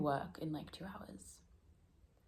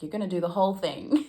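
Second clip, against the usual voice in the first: self-pep talk; 11 dB louder than usual. Retold or imitated. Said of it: "I'm like".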